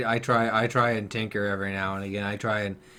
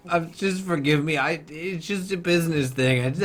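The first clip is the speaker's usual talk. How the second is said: higher-pitched begging excuse; acted pleading; 6 semitones higher than usual. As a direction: plead like a caught father excusing himself. Father pleads after being caught.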